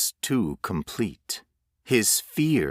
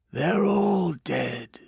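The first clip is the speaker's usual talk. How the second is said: eerie stranger; 9 semitones higher, slower than usual. A narrator's reading. Ghostly voice announces death.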